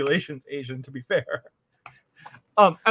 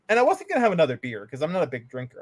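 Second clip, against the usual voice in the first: ordinary delivery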